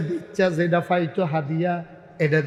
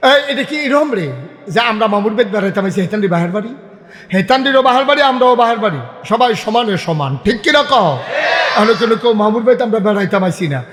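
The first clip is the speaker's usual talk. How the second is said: loud, raised-voice challenge; heated preaching; 4 semitones higher, 8 dB louder than usual. Real, not acted. Preacher insisting everyone belongs to this country, loud heated challenge. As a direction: hammer it out loudly to the crowd.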